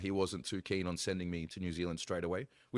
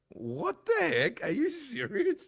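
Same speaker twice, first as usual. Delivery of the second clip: very high, drawn-out incredulous squeal with a laugh; mock disbelief; 7 dB louder, much slower than usual. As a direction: protest to God in loud, slow, comic outrage. Mock disbelief at God's call, laughing.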